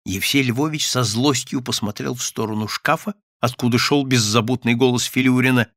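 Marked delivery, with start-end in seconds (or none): none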